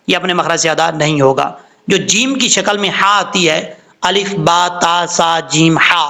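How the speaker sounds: normal talking voice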